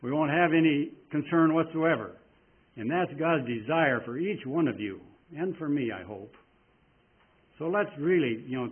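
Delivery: normal talking voice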